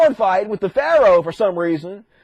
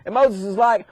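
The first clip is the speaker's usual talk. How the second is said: normal talking voice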